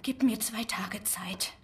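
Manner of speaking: slow, breathless whisper; softly pleading with the man for time; whispered plea. Acted. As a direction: beg him under your breath. Dulcie pleads quietly for time.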